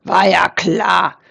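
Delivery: slow, gruff boar grumble; disgruntled boar voice; grunting boar. A narrator's reading. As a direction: grunt it out sourly and slowly. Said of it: "grunzte Eberhard das Wildschwein".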